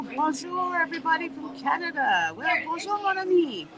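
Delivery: high, lively Louisiana French greeting. Real, not acted. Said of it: "My French sounds like Louisiana French".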